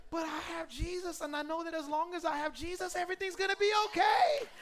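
high voice building to a loud shout; exultant preaching. Real, not acted. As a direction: climb from high testimony to full-volume proclamation. Preacher climbing to exultant declaration.

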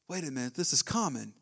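usual speaking voice

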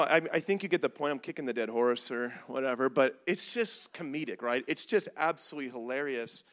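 usual speaking voice

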